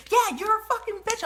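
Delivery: very high mock shriek of an insult. Quoting the woman yelling an insult in line, in a high mock shriek.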